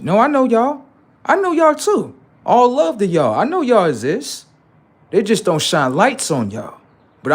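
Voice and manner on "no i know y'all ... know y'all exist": much higher, bright and eager; excited shout-out. Real, not acted. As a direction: call out to them warmly and excitedly, pitch way up. Shout-out to hidden supporters.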